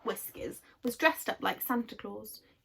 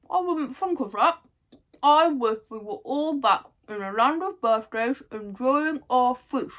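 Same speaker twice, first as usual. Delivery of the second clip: eager wish; 3 semitones higher, 9 dB louder than usual. Acted. Franny wishing eagerly.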